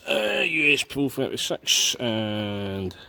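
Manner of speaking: ordinary delivery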